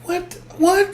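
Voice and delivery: tiny, high, soft mock bewilderment; baffled. Fan acting out the character's look, baffled. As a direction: squeak it quietly, acting baffled.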